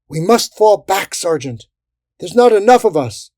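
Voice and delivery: high, loud call to retreat; urgent plea. A narrator's reading. Soldier begging the sergeant to retreat, urgent shout. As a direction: urge retreat over the din.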